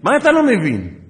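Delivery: high, loud imitated shout; exploding mock-furious question; angry. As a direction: yell it like an exasperated sergeant. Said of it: "כל מה שהוא התעצבן".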